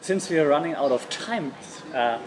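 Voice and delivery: ordinary talk, nothing marked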